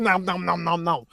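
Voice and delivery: mimed greedy chomping, syllable after syllable; nom-nom munching noises. Retold or imitated. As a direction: playfully imitate gobbling food. Mimics greedy eating.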